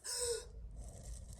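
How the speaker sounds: high, airy gasp; sharp shocked intake of breath for show; demonstrated gasp. Shown as a demonstration. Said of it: "you have to gasp like this".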